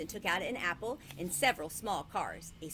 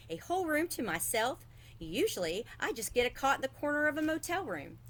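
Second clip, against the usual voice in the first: put-on higher, quick child's voice; excited boy; 7 semitones higher than usual. A narrator's reading. Boy telling his parents about the room, higher and eager. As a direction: sound like a delighted kid.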